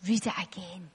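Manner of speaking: very low, hushed inner voice; inner spirit voice. Retold or imitated. Preacher retelling what the voice in her spirit said, low and hushed. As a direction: drop right down, soft and deep. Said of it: "just a voice in my spirit".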